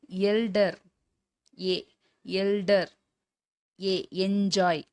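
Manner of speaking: "எல்டர்", "என்ஜாய்" opening on "ye", slowly spaced; ஏ sound demo. Shown as a demonstration. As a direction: model "எல்டர்" and "என்ஜாய்" singly, isolating the "e" vowel. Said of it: "இது எல்லாமே ஏ". ("எல்டர்", "என்ஜாய்" ஏ ஒலியைப் பிரித்துக் காட்டி மெதுவாக)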